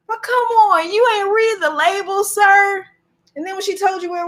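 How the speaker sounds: high-pitched exasperated appeal; mock-outraged, high-pitched reproach; incredulous scolding. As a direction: plead in disbelief with the clerk. Acted scolding of the clerk.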